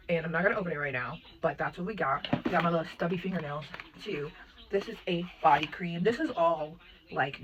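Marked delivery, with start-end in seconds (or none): none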